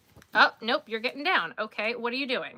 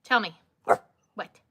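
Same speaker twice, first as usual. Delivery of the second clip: ordinary delivery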